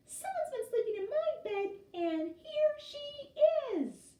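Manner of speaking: very high squeal, slowing on here she; squealing Baby Bear. A narrator's reading. Baby Bear finding Aluki in her bed, very high squeal. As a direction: squeal like a tiny startled cub.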